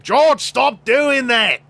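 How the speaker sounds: high, loud, slow Australian scolding; hammed-up Aussie mate calling out a warning; broad Australian accent. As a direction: stretch it out in a cartoonish Australian accent. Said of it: "a friend in his life who goes like".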